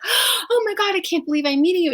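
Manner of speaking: high, loud imitation of a gushing fan; mimicked thrilled outburst; star-struck excitement. Acting out a star-struck author meeting her.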